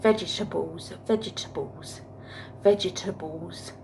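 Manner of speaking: "vegetables" said the wrong way, in mock imitation; a mocking imitation of how Marcus says "vegetables"; imitating Marcus's vegetables. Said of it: "He calls them veg".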